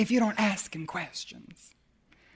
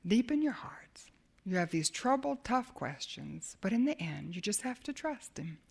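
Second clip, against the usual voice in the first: whispered, fading softer to the end; intimate hush; 3 semitones lower, 8 dB quieter than usual. Real, not acted. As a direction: speak right into their heart. Intimate sermon appeal.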